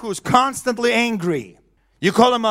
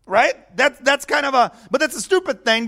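ordinary delivery

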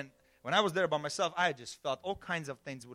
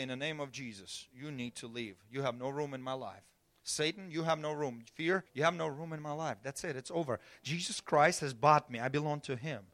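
calm, confident prayer; 2 semitones lower, 4 dB quieter than usual. Retold or imitated. Preacher showing how God told him to speak, calm and softer. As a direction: speak calmly and softer, with quiet authority. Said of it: "Just speak calmly".

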